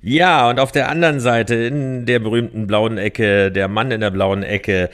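loud ring announcer, laughing through it; lucha libre announcer. Acted. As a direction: boom it out like a fight intro. Announces cohost from the blue corner.

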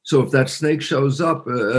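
usual speaking voice

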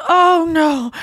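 high, loud dismayed oh no; hammed-up mortified groan; mock dismay. Rachel caught by Ross, high and mortified. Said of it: "she goes".

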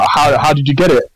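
ordinary delivery

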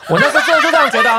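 laughs through a high, quick confession; laughing. Real, not acted. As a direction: giggle it out fast and high. (边笑边说，语调高)

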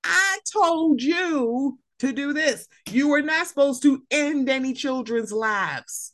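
high, slow, drawn-out scold; scolding mother. Retold or imitated. Mother scolding her son for killing, a high slow mock scold. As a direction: lay into the child like a furious mom.

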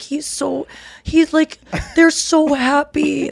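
slow, high, gushing friend voice; Maddie impression. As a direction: gush it out slowly like a giddy friend. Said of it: "That's a good Maddie".

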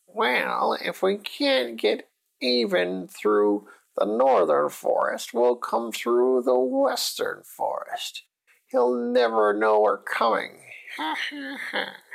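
high, slow witch voice with a thin cackle; scheming witch. Acted. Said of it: "And the witches had it all planned out".

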